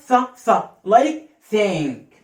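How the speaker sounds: ث said slowly as th in thank; th sound. Shown as a demonstration. Teacher demonstrating ث slowly. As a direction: push out ث between the teeth. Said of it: "makes the sound ث".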